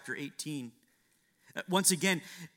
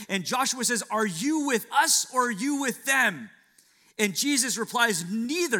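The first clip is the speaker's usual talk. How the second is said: higher, louder sermon climax; fiery preaching; 6 semitones higher, 7 dB louder than usual. Acted. Preacher rouses the congregation about Joshua's battle, fiery. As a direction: preach it with rising fire.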